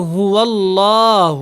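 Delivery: هو اللہ slow with thick ل; heavy lam. Shown as a demonstration. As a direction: weigh down the ل in اللہ. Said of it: "لام کو ہم نے موٹا پڑھنا ہے". (موٹا لام آہستہ)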